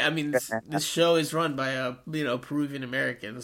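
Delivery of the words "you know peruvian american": ordinary talk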